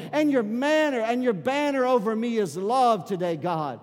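loud, high, preaching at full force. Acted. Pastor urging the church to praise, shouting in worship. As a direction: preach it out to the church with rising fire.

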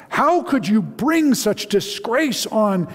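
high, slow, anguished howl; the father howls at his daughter in outrage. Acted. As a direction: wail it out in outraged pain. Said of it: "The voice was howling".

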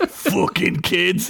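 put-on very slow, grumbling old skeleton; grumpy skeleton. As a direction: curse the kids as you crumble. Skeleton's dying words.

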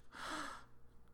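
sharp, voiceless intake of breath; imitated shocked gasp. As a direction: gasp like a startled child. Daughter sees the reply.

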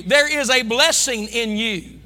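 ordinary delivery

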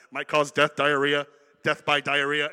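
over-loud parody of a drug commercial voice; mock drug ad disclaimer. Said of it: "at the end, they're like".

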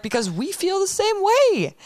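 high-pitched, emphatic outburst; impassioned retold cry. Host quotes officers who share her views, impassioned. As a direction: voice leaps up as if protesting.

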